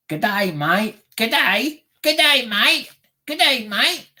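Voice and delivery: high, loud mock Australian G'day; Australian accent. Shown as a demonstration. Streamer trying out an Australian accent for chat. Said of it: "try to say it in a Aust-, Australian".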